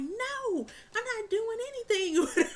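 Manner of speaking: put-on high, fast kid voice; playful child protest; mock kid denial. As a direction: squeal it like a guilty little boy. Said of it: "he was just running like".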